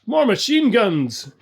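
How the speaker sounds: high, loud shout, drawn out; yell. A narrator's reading. Bill shouting to Tom for guns, yell. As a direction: yell it down the trench. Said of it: "Bill yelled to Tom".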